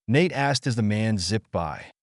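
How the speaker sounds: ordinary delivery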